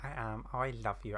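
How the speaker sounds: normal talking voice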